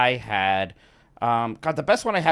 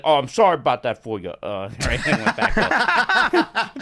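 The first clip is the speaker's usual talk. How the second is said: put-on New York accent; 4 dB louder than usual. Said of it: "Was that your New York accent?".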